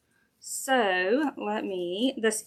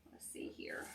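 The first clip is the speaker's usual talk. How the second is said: breathy whisper to herself; 22 dB quieter than usual. Real, not acted. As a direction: whisper it under your breath. Muttering to herself mid-craft.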